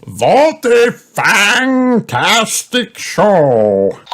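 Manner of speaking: slow, swooping, loud vampire voice; high, drawn-out hammy vampire praise; vampire grandpa. A narrator's reading. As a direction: relish each word like a theatrical vampire. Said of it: "said Vampire Grandpa Pig".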